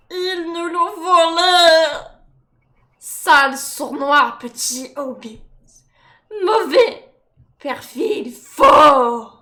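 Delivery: loud, venomous creature accusation; slow creature rant with shouted peaks; Gollum. Acted.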